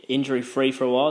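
ordinary talk, nothing marked

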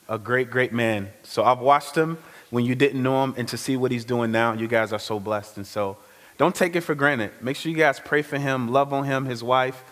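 ordinary delivery

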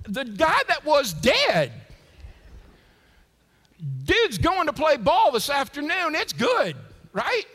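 loud, high-pitched jubilation; exultant shout. Real, not acted. Preacher celebrating the man who was dead, exultant shout. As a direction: belt out the punchline, thrilled.

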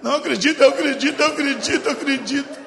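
put-on scared teen insisting he believes now; frightened boy. Boy frightened by the demon, rushing to say he believes. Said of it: "Eu falei".

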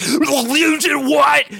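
high, drawn-out growly outburst; Logan. Acted. Logan reacts to the tests, loudly incredulous. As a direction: stretch it into hammed-up outrage. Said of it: "And Logan's like".

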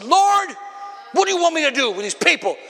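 high, pleading outburst on the first word; playing a leader on his last nerve; exasperated Moses. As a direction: cry out to God at wit's end, acted. Fed-up Moses pleads with God.

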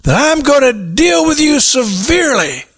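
hammed-up menace at a debtor; unforgiving servant threatening his debtor, high-pitched; threatening creditor. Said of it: "and he threatened him".

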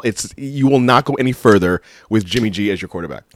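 normal talking voice

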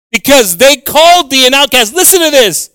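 loud, high, impassioned scripture preaching; full-force preaching. Real, not acted. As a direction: belt out the verse to the congregation.

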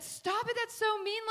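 high, loud yell; retold yell. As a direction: yell at them to stop teasing. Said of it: "I was yelling because I said".

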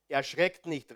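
ordinary talk, nothing marked

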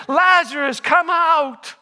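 high-pitched commanding call; shouted command. Retold or imitated. Preacher re-enacts Jesus summoning Lazarus, calling out high. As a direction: call it out to the tomb.

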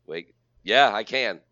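big host voice, lifted on key words; show-opening announcer. Acted. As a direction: hype up the episode like a host. Hosts the show's opening.

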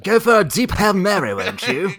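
mock British play call; slight British accent. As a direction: playfully put on a polite British accent. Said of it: "talking in a slight British accent".

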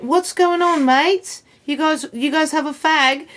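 high-pitched mock British lad; British accent. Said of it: "And now he's British".

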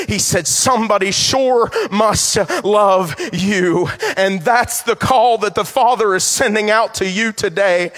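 loud, rising preacher's call; fervent loud pulpit preaching; preaching at full force. Acted. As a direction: build it like a revival climax. Preaching climax.

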